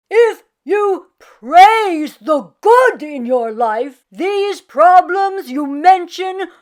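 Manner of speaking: slow, loud, earnest rabbi advice; earnest old rabbi. Acted. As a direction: counsel her gently but firmly. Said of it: "said the rabbi".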